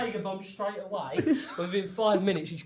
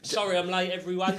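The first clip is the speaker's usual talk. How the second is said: ordinary delivery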